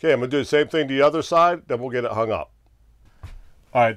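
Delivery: normal talking voice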